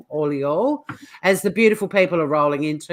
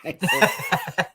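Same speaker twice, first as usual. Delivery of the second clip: mock outrage; much slower than usual. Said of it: "That's racist".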